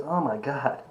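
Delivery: soft, half-whispered mock moaning with a laugh; imitating himself in pain, hushed and breaking into a laugh; retold headache misery. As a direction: ham up a pounding headache, barely voiced. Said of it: "And I'm about in tears".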